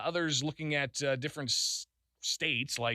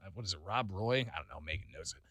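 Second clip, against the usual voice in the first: usual speaking voice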